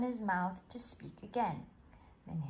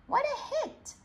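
much higher, delighted shout; cheering cricketer; 4 dB louder than usual. A narrator's reading. A cricket player cheers his own hit, much higher and excited. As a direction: cheer a great hit, high and bright. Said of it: "came a voice".

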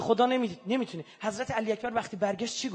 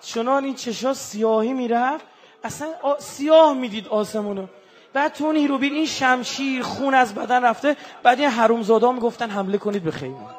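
loud, higher, impassioned sermon cry; full-force preaching; 4 semitones higher, 9 dB louder than usual. Acted. Preacher denouncing the killers before the congregation, shouting. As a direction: thunder at the enemies of the child.

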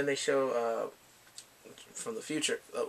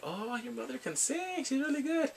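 high-pitched admiring gush; acted admiring praise; 10 semitones higher, 4 dB quieter than usual. Karaoke owner praising the mother's singing, high and admiring. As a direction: gush in a raised, light voice.

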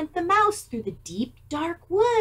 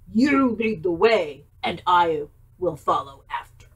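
Gruffalo voice; 8 semitones lower, 4 dB louder than usual. Acted. Gruffalo laughing.

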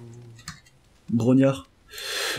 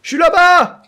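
high, loud shout to a friend; calling out; 14 semitones higher, 6 dB louder, slower than usual. Real, not acted. Player telling his friend where he is, calling out. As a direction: holler it from far away.

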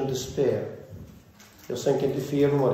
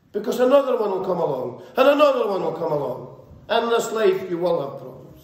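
raised, driving sermon voice at full force; fiery preaching; 8 semitones higher than usual. Acted. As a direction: preach it out to the congregation, pushing higher.

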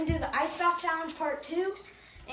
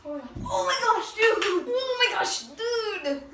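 loud startled cry from the cold; shocked yell; 5 semitones higher, 4 dB louder, much slower than usual. Real, not acted. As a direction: gasp it out at the icy shock. First step into ice water.

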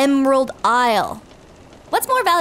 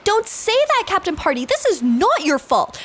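ordinary talk, nothing marked

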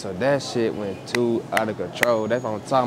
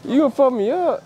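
mock outrage; 14 semitones higher than usual. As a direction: whine it up as a fake-offended shopper. Prank confrontation over chips.

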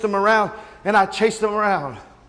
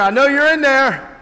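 raised, louder calling through a door; retold yell; 5 semitones higher, 5 dB louder than usual. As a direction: call in to people hiding inside. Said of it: "I yelled in there".

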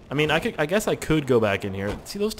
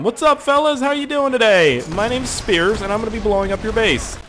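much higher, louder cheery taunt; mock friendly greeting; 9 semitones higher, 5 dB louder than usual. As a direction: greet them like a chipper salesman before the kill. Taunting enemies in game.

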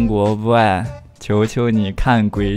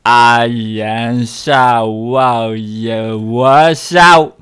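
very slow, low, louder stretched syllables; backwards speech; 6 semitones lower, 7 dB louder, much slower than usual. Acted. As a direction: drag syllables like a reversed clip. Guessing game imitating reversed audio. (极慢倒放腔)

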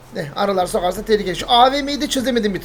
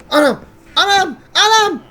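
panicked shout; 9 semitones higher, 5 dB louder, slower than usual. Acted. Finds his belongings gone.

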